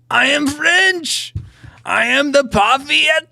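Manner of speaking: put-on high, loud, hammy French accent; over-the-top French, stretched endings. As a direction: declare it proudly in mock French. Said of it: "with my French accent".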